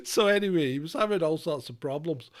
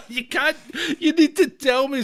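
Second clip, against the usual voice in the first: laughing; 9 semitones higher, 6 dB louder than usual. Real, not acted. Laughing scolding caller on air.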